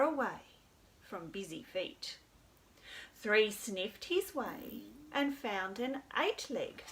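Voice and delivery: storytime narration; reading to children. A narrator's reading.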